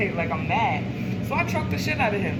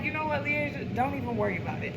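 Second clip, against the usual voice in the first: calm and quiet; 3 semitones higher, 9 dB quieter, faster than usual. Retold or imitated. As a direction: calmly wave her off, hushed. Calmly stopping her cousin.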